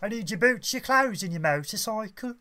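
high Brummie action hero; Birmingham accent. Acted. Redubbed action hero demanding clothes, Birmingham accent. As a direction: make the demand in comic Brummie. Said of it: "Arnold Schwarzenegger films and a Birmingham accent".

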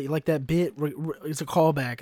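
ordinary delivery

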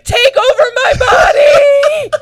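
high, loud, slow ecstatic yell; dramatic anime cry. Retold or imitated. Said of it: "he was like".

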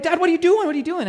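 put-on high, loud panicked boy; alarmed son yelling at his dad; freaking out. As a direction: imitate a kid in a rising panic. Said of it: "starts freaking out".

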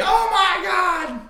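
high, slow wrestling announcer yell; WWE announcer scream. Retold or imitated. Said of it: "like, W W E".

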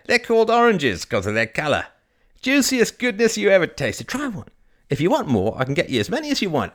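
high, loud market patter; old fruit vendor, enthusiastic. Acted. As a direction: sell it eagerly, pitch jumping up.

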